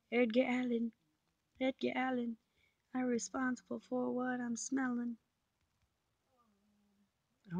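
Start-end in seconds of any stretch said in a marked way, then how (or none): 0.1-5.2 high silly voice. Pretend.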